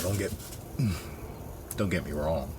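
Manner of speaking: words pushed out through a burp; talking through a burp. Real, not acted. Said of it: "Sorry for the burp".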